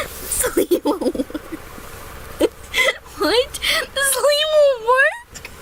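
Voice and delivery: giggling through the words, high and breaking; can't stop laughing while talking; laughing fit. Real, not acted. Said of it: "calm down".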